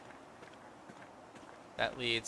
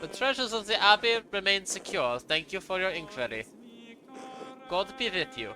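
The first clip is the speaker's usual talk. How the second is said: put-on higher-pitched, formal German-accented monk; 5 semitones higher than usual. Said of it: "I gave him a German accent".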